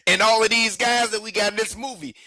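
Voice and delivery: can't believe anyone would argue this; fired-up defence of a film; worked up. Real, not acted. Arguing Sinners deserves the awards.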